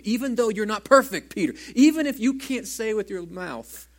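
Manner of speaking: loud and high, pleading with Peter; a preacher's loud, high plea; impassioned cry. Acted. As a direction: cry it out to him from the pulpit.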